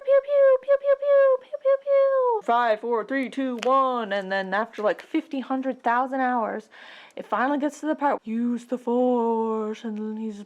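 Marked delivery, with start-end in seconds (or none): 0.0-2.4 high, loud pew pew; blaster fire. Acted. Vader's fighters attacking, high loud blasts. 8.3-9.8 very slow echoing whisper-voice; ghostly Ben. Acted. Ben's ghost guiding Luke, slow mystical voice.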